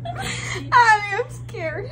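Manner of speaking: high, whiny mock fear; mock scared. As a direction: playfully whimper you're scared. Drunk friend cries out playfully.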